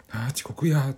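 high startled yelp; panicked. Retold or imitated. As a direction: jolt awake in panic. Panicked thought, late for work.

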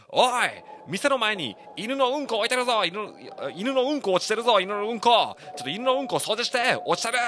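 high, loud shouting at the whole shop; retold loud yelling. Old man bursts in yelling about dog poop, loud. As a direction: bellow it across the restaurant like a cranky old man. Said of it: "ってめっちゃ大声で叫ばれて".

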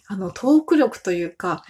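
ordinary talk, nothing marked

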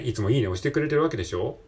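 ordinary talk, nothing marked

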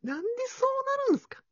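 high, fast put-on character voice; a high voice, quick and rounded. Shown as a demonstration. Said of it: "だいぶ丸くなったな". (高く速いキャラ声)

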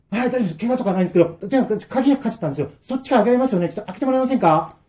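high, fast, anxious begging; desperate pleading. Acted. Trapped friends plead with the old man, desperate. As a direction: plead urgently to be let out. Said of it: "こっちは必死なんだけど".

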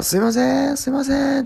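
high, long-held calling voice; pleading caller. Retold or imitated. Visitor urging the resident to come out, imploring call. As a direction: call insistently to someone behind a door. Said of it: "そういう声だよ".

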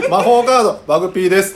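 loud, high card-game call; hyped card announcer. Pretend. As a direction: shout the card like a duel move. Answering joke card intro.

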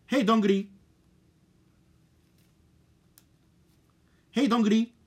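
forceful call to the phone; strong call. Shown as a demonstration. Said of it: "それちょっと強めに言ってるから".

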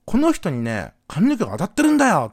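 imitating a loud, high-pitched telling-off; acted-out outraged stranger; scolding older man. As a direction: build from firm to an indignant shout. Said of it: "さらにそのおっさんは".

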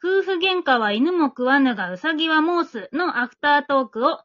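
ordinary delivery

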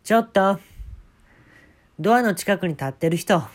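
loud Kansai-dialect stage MC; band MC voice. Retold or imitated. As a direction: shout it out to the crowd as MC. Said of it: "そっからまたエムシーですよ".

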